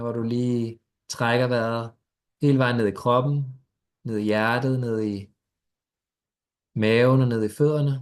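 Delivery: guided meditation. Acted. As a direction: ease them gently into stillness.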